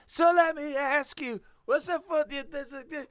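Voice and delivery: put-on country accent. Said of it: "put it on your most country accent".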